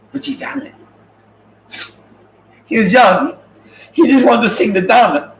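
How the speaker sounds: loud and high, pleading his case; loud defense. Acted. To the audience, defending [name], loudly.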